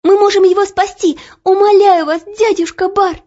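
put-on high, loud girlish plea; urgent pleading. A narrator's reading. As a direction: implore with all urgency. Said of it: "быстро говорила Оля".

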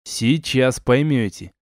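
plain reading voice

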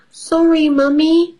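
put-on high apologetic child voice; little girl voice. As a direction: say sorry like a small girl. Peppa voiced in story.

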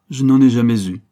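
loud, hard-hit retort; forceful denial. A narrator's reading. Banker rejecting the countess's appeal, forceful denial. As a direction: deny it with full force.

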